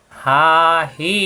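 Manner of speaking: হা হি each held long and slow; stretched vowels. Shown as a demonstration. Teacher stretching হা হি for students. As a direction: draw out হা and হি. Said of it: "লম্বা করে পড়তে হবে".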